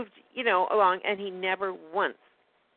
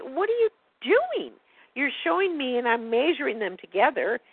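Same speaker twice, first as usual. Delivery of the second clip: exasperated; 8 semitones higher, 3 dB louder than usual. Retold or imitated. Challenging the dentist about the short teeth, exasperated. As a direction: let the disbelief stretch out.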